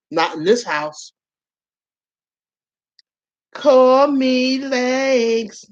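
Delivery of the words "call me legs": very slow, drawn-out proud nickname; mock grand nickname. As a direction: savor the name like a grand title. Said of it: "slow drawn-out self-naming".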